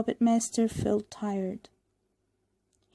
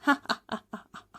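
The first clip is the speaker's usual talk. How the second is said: laughing; much faster than usual. A narrator's reading. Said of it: "he laughed".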